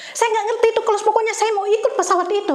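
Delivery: high, loud, fast refusal to take no; insistent demand. Retold or imitated. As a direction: push back hard at the clerk, high and quick. Insisting to ticket clerk.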